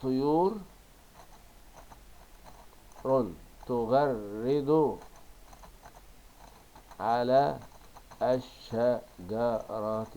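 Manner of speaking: very slow, loud, chopped "طيور تغرد على الشجرة"; stretched, separated dictation of "طيور تغرد على الشجرة"; dictating syllable by syllable. Shown as a demonstration. Reordered words dictated while writing.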